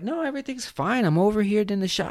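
high, put-on reassuring call; mock girlfriend reply. Girlfriend answering from the shower, reassuring.

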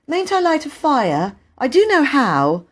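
put-on high, pleading little girl voice; a child asking to light the fire, high and eager. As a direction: beg like a keen child.

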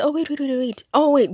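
insistent, retold interruption; urgent calling out. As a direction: imitate someone hurriedly stopping a closing gate. Ex stops her closing gate.

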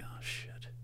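whispered under the breath; a barely audible, sinking whisper; hushed dismay. Retold or imitated. Retelling his own reaction when the bag is caught, hushed dismay.

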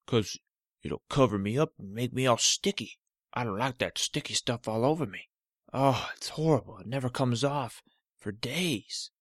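a cranky toe refusing the Band-Aid; stubborn talking toe. Acted. Cut toe protesting to Olivia, loud and grumpy.

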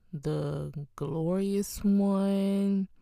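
drawn-out, savoured phrases; slow preaching. Real, not acted. Preacher describing heaven to the congregation, slow and drawn out. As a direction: linger on every word of heaven.

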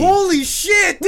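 high, slow amazed yell; excited shout. Real, not acted. Friend spelled it right.

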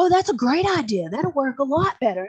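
high, loud gushing imitation; mock enthusiasm. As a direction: gush over the idea in mocking imitation. Mimics coworkers praising male's idea.

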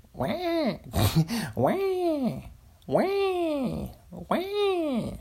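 very high squealing wah over and over; mock eagle scream. Guessing aloud what a screaming eagle sounds like, very high mock screech. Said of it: "That's what it sound like".

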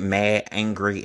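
ordinary delivery

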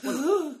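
sharp high gasp of awe; excited nerd gasp. Retold or imitated. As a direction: gasp like a thrilled fan. Nerds react in awe.

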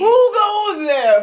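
very high, loud and slow hammed-up challenge; exaggerated guard challenge. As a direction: stretch it out like a spooked sentry. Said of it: "But I don't sound like this".

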